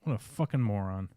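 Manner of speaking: ordinary talk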